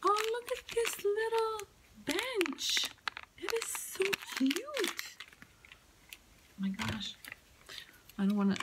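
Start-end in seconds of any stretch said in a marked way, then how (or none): 0.0-5.0 high excited gushing. Real, not acted. Gushing over a little bench. 6.5-7.2 whispered. Real, not acted. Gushing over a little bench.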